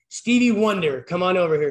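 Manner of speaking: calling out. Retold or imitated. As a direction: call him over across the rink. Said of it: "Then Bob called me this".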